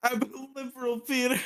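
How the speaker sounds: a high squeaky cartoon sidekick. Repeating the sidekick line.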